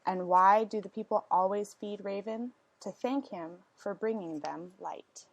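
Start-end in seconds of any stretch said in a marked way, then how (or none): none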